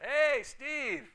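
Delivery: loud call across a parking lot. Retold or imitated. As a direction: shout a name from afar. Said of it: "they're like".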